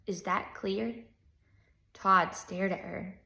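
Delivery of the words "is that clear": restrained teacher's warning; quiet warning. A narrator's reading. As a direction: drop the volume on the question.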